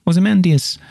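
bright high Ozymandias with a drop; normal take. Shown as a demonstration. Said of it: "that'd be normal".

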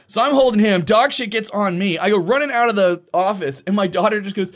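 ordinary delivery

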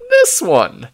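put-on loud, high old man's cry; hollering. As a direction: holler it, showing the thing off. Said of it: "hollers the old man".

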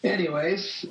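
usual speaking voice

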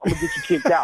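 jokey threat collapsing into laughter; mock threat dissolving into real laughs; laughing through it. Real, not acted. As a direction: crack up as you deliver it. Teasing threat, breaks laughing.

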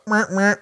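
high-pitched double squeak; imitated toy squeak. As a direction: mimic a squeeze toy squeaking.

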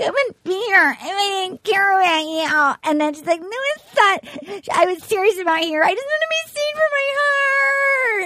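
hammed-up weeping, climbing to a high wail; mock crying. Imitating a contestant weeping to him, exaggerated high sobbing. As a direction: blubber it, stretching the last words into a squeal. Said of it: "she wept to him and she's, like, crying".

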